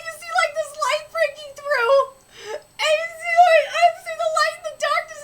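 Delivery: high, loud, overwhelmed rush; real gushing excitement. Real, not acted. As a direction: gush as a fan overcome by the art. Gushing over the manga art.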